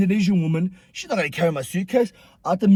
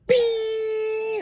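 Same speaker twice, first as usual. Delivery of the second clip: long, high ringing tone; thin, sustained high-pitched whine; flashbang ringing. Retold or imitated. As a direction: imitate ears ringing after a blast. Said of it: "imagine a flashbang".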